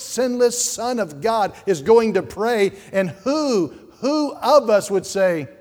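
loud, high, pleading pulpit cry; fiery preaching. Acted. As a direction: preach it hard at the back pews. Preaching at full force.

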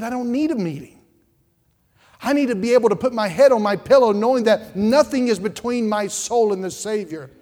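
loud, high, shouting pulpit climax; full-force preaching. Acted. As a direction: thunder it out, building the call.

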